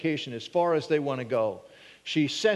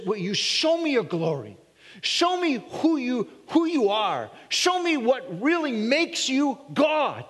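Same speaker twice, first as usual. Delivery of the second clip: pleading Moses; 7 semitones higher than usual. Acted. Moses pleading to see God.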